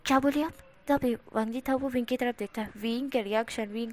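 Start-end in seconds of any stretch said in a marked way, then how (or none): 0.1-0.5 collar-grabbing demand. Acted. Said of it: "collar पकड़ते हुए कहता है".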